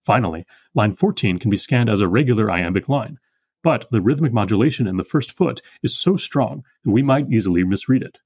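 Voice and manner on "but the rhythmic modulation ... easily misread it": ordinary talk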